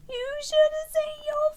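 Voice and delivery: high, loud frog voice, teasing; cheeky frog. Acted. Frog mocking Terence's jump, high loud teasing voice. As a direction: tease him like a cheeky frog.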